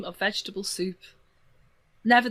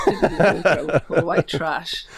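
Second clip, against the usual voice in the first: laughing teasing; 6 dB louder than usual. Real, not acted.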